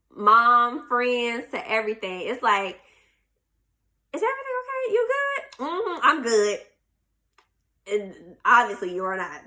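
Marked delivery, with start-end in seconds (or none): 4.1-5.4 very high-pitched mock concern; concerned check-in. 5.6-6.6 higher-pitched passive-aggressive brush-off. Acted. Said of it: "Appearing upset but refusing to admit it".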